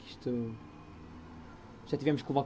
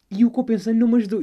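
loud exasperated; 7 semitones higher, 7 dB louder, faster than usual. Real, not acted. Outburst about the useless pill.

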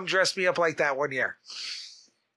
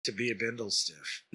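low, slow, soft announcer voice; radio announcer; 7 semitones lower, 5 dB quieter, slower than usual. Acted. Hopeful bindle stiff stating his goal, low announcer voice. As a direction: intone it like a radio announcer, pausing between phrases. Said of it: "'cause you speak like a radio announcer".

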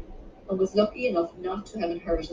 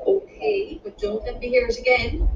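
scolding; 4 semitones higher, 4 dB louder than usual. A narrator's reading. Mother accepts Hannah's apology with a warning, scolding. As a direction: let it go with a raised-pitch warning. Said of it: "Eva scolded".

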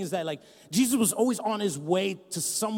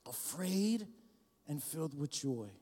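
hushed whisper; 2 semitones lower, 11 dB quieter, slower than usual. Acted. Pastor pausing the reading, whispering to savour the words. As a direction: whisper Afraid, then very soft.

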